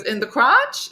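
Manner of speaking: mock shocked echo; surprised question. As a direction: ask it in disbelief. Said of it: "She goes".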